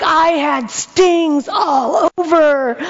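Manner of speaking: high, slow, exaggerated kid wail; milk the tale for all it's worth; dramatic kids. Kids retelling the bee story bigger each time, high drawn-out wail. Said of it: "They're like".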